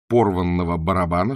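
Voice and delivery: usual speaking voice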